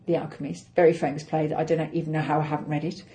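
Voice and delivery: normal talking voice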